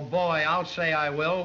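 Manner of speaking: ordinary talk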